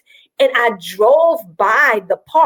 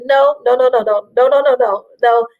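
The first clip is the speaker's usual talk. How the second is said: normal talking voice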